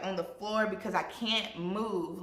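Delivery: ordinary talk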